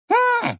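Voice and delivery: very high squeaky hm; squeaked hm. Acted. Bird's beak echoing grandfather, tiny high squeak. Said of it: "пропищал нос".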